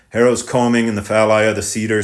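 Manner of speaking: ordinary delivery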